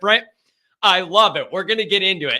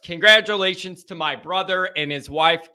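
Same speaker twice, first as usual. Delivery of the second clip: usual speaking voice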